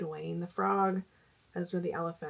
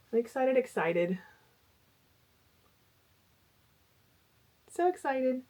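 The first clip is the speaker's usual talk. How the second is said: 'excited' repeated, climbing higher each time; building excitement; 6 semitones higher than usual. Real, not acted. Crocheter gushing over her new plush patterns, building from excited to higher and giddier. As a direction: let the glee bubble up word by word.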